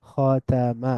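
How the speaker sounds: খতামা slowly as kho, ta, ma; syllable by syllable. Shown as a demonstration. Teacher breaking down the word, খতামা syllable by syllable. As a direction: split খতামা into three drawn-out syllables. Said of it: "একটু মিলিয়ে মিলিয়ে পড়ব".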